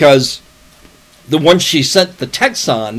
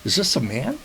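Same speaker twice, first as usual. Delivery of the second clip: a hint of an old woman's voice, quick; lightly mock the caller's strange voice; mimicking an odd voice; 5 semitones lower, 7 dB quieter than usual. Man telling a friend about a scam call, imitating the caller lightly. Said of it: "the voice that came back was like".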